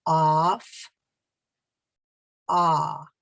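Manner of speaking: Off, then only the open ah alone; stop on the vowel. Shown as a demonstration. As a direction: cut off at its vowel. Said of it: "Now you say it with me".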